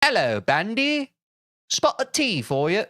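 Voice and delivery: lightly put-on English voice; mock British accent. Streamer teasing British viewers in a mock British accent. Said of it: "was that offensive to British people".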